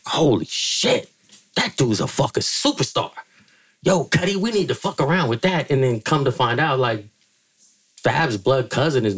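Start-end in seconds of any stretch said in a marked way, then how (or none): 0.1-5.7 imitating [name] impressed. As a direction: lightly voice Dre's amazement. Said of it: "Dre was like".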